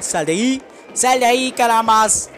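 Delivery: loud, high frustrated shout at the screen; frustrated yell. Real, not acted. Gamer yelling at a hiding enemy, frustrated shout.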